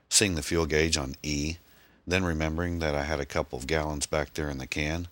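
ordinary delivery

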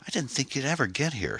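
she bursts out the moment he opens up; exclaiming. A narrator's reading. Shelley soaked at Sam's door, exclaiming in relief. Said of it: "she exclaimed".

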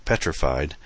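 plain reading voice

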